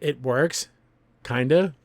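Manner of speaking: ordinary talk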